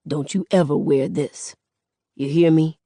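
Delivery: lightly voiced stern father; serious warning. A narrator's reading. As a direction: impress it on the child, understated. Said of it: "looks at me real seriously and says".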